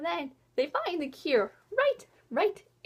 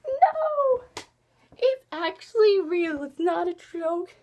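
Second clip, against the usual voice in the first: hammed-up anguished acceptance; emotional realization; 3 semitones higher, 4 dB louder, slower than usual. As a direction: cry out, then drag out the words in dismay. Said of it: "that's when I started getting emotional".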